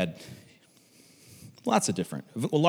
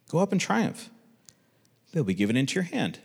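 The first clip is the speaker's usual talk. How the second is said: put-on prophecy; 6 semitones higher than usual. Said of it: "if it was a tone of voice".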